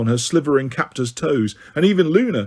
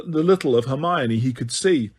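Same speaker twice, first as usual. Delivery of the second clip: ordinary delivery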